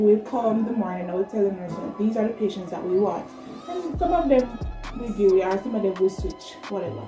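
ordinary talk

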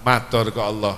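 normal talking voice

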